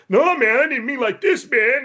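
high, pleading voice of the freaked-out friend; panicky friend. Retold or imitated. Retelling his panicking gaming buddy, high pleading whine. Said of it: "And he was like".

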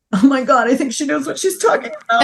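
quick, high, laughing disbelief; laughing oh-my-God realization; amazed laughing aside. Retold or imitated. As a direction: blurt it out half-laughing at how right she is. Retold reaction to sister's insight.

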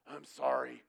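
mock kid's apology, blurted very loudly; grudging forced apology. Child told by parent to apologize, mock loud forced sorry. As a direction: imitate a child yelling an insincere sorry.

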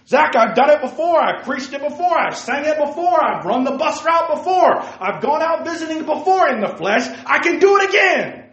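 loud, high, overconfident bragging; cocky boast. Acted. Preacher mimics a boastful Samson, loud and cocky.

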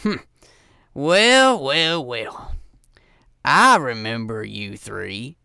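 drawn-out, menacing gruff greeting; gruff man. Acted. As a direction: play a gruff man sizing up old foes. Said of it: "hear a gruff man going".

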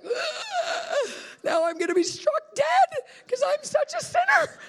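very high, loud mock terror; hammed-up cry of impending doom; panicked wail. Preacher re-enacts fearing God will strike her, panicked wail. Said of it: "And I was like".

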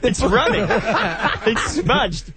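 high, laughing wail over ruined notes; laughing dismay. Real, not acted. Host watching his soaked notes smudge, laughing dismay. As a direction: squeal it out through laughter.

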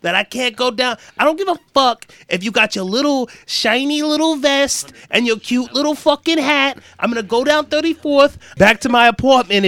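rising, high-pitched mock outrage; indignant New Yorker. New Yorker refusing to be turned back, indignant. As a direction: build into an affronted New Yorker's rant. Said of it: "and they're like".

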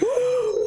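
sharp high gasp of shock; mimicked audience gasp.

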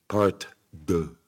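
lightly hammed-up French accent. Shown as a demonstration. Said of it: "going to utilize my seven years of French".

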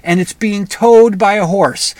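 ordinary delivery

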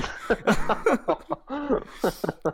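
big high burst of laughter; loud laugh. Real, not acted. Laughing at own bro act.

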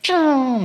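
high-pitched mouth-made gunshot zing; imitated ricochet. Preacher mimics a gun fired at Superman, imitated ricochet. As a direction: make the zing of a bullet bouncing off.

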